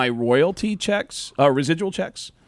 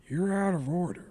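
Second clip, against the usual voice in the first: Al Pacino impression; 4 semitones higher, 7 dB quieter than usual. Pacino among broken washers, quiet opening line. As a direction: start the Pacino rant held back and quiet. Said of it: "they cut to me as Al Pacino".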